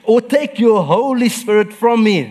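high and loud pleading; a preacher's rising plea; fervent prayer. Acted. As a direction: beg not to be cast away. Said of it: "he says".

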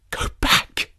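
urgent hushed warning from a woman; breathy, pressing command; low, eager voice. A narrator's reading. Stapleton's sister warns a stranger on the moor, low and eager. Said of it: "She spoke in a low, eager voice".

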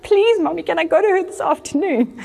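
imitated high, eager begging; pleading child. Daughter begging mother in retold scene.

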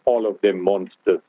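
ordinary talk

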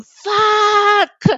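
long, high, loud drawn-out yell; retold scream. Mother re-enacts screaming with the pushing, long high yell. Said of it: "I just kept on screaming".

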